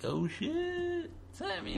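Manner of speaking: high, soft clueless gasp; mock clueless panic. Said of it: "And you're like".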